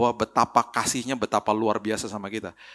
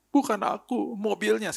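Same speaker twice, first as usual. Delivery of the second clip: put-on crying child; 10 semitones higher, slower than usual. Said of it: "Dia, oh, nangis".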